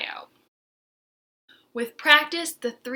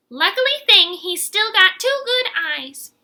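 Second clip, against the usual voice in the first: Aggie's voice; 9 semitones higher, 10 dB louder than usual. Acted. Aggie comments on injured Verdi.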